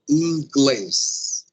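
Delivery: "inglês" slowly, weight on the final glês; second syllable stressed. Shown as a demonstration. Teacher shows where the word is marked, stressing glês in "inglês". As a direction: draw out and punch the glês of "inglês". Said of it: "está marcada na segunda sílaba".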